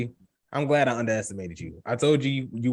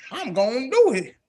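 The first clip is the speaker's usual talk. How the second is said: dramatic mother; 10 semitones higher than usual. Acted. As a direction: read out mama's vow with drama. Reading out a mother's quote dramatically.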